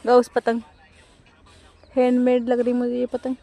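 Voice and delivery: ordinary talk, nothing marked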